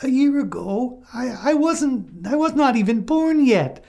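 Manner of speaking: high, halting, frightened lamb; trembling lamb. A narrator's reading. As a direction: stammer it out like a scared lamb. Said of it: "said the trembling lamb".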